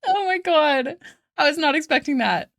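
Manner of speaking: high, loud, breaking out of laughter; real amused shock. Real, not acted. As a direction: caught off guard and cracking up. Caught off guard by chat joke.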